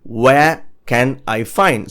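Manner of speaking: "Where can I find" drawn out with gaps between words; very slow model. Shown as a demonstration. Teacher introducing the next phrase to learners, very slow. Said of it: "And the next one is".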